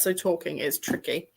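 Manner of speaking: ordinary talk, nothing marked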